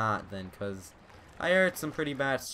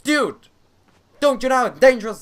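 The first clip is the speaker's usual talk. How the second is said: loud, high panicked shouts; alarmed yelling; 7 semitones higher, 11 dB louder than usual. Real, not acted. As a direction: yell in alarm at the danger. Panicked yelling at dog in game.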